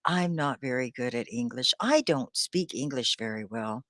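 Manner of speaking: low, soft, self-deprecating; quietly doubting your own ability; learner's self-doubt. Retold or imitated. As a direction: mildly mimic a discouraged student. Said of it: "Now, do you ever say".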